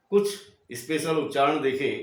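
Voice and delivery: ordinary talk, nothing marked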